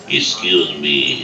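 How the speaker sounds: slow, low begging excuse me; mock crying plea. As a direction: plead like a guilty man in tears. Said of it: "he's crying".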